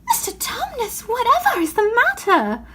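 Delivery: put-on a child's high alarmed question; anxious high girl. Lucy worried by the crying faun, high and anxious.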